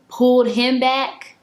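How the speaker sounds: ordinary talk, nothing marked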